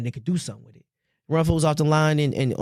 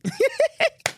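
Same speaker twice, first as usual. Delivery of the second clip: very high, giggly cackle; laughing. Real, not acted. Podcaster cracking up after a basketball rant, laughing. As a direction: crack up at your own riff.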